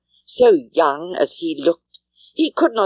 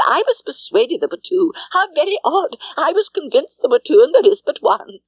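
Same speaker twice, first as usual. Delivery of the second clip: put-on higher, fussy, nonstop old-lady prattle; a higher, twittering spinster who never stops talking; chattering Miss Bates; 3 semitones higher than usual. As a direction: rattle on eagerly like a kindly busybody. Narrator voices chattering Miss Bates.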